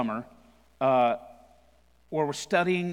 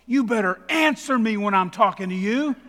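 high, loud scolding yell; 8 semitones higher, 7 dB louder than usual. Retold or imitated. Pastor bursting from the stall to scare his friend, loud high yell. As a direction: bust out and blast him with it. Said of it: "and I yell".

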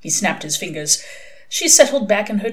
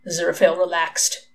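plain reading voice

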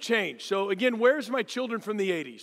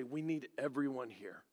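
soft solemn; 8 semitones lower, 13 dB quieter than usual. Retold or imitated. Youth minister to the circle, soft and solemn. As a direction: lower and softer, solemn. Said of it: "our youth minister would say".

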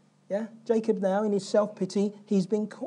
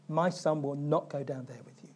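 defiant refusal; 6 semitones lower, 9 dB quieter than usual. Retold or imitated. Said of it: "There's this defiance".

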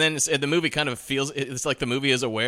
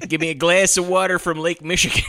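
put-on Chicago accent; 4 semitones higher, 4 dB louder than usual. Chicago voice through laughter.

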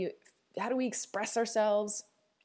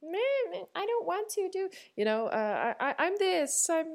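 put-on much higher, whining little-girl voice; whiny inner child; 12 semitones higher than usual. Voicing her inner child as a whiny little kid. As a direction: whine it out like a sulky small child. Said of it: "she could sound whiny".

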